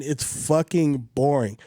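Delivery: normal talking voice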